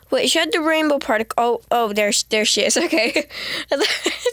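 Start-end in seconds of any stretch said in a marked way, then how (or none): none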